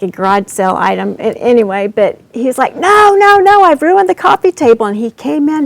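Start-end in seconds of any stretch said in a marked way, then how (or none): 2.8-4.9 put-on high, loud anxious child's wail; retold anxious child insisting he ruined the table; panicked child. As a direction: protest like a frightened little boy. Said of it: "he was flushed with anxiety".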